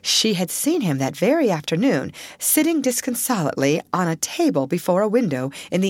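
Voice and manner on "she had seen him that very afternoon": plain reading voice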